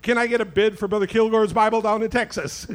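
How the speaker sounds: loud, high auction call; auctioneer. Pretend. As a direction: open the bidding like an auctioneer. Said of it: "I'm getting weird".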